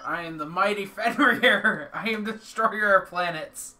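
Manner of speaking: loud booming boast, laughing once; mighty villain boast. Acted. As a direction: proclaim yourself a planet destroyer. Roleplaying mighty Fenrir card.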